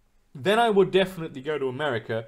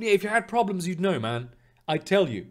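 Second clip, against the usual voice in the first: ordinary talk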